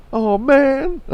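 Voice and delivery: very high, loud mock monkey wail; imitated comic monkey voice. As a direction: wail it as a put-upon cartoon monkey. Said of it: "he started doing this voice".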